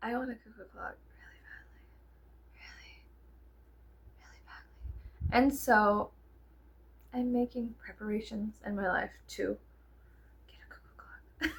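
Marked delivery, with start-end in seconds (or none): 0.1-5.3 hushed, longing whisper. Real, not acted. Vlogger confessing her craving to viewers, whispered. 10.5-11.5 hushed, longing whisper. Real, not acted. Vlogger confessing her craving to viewers, whispered.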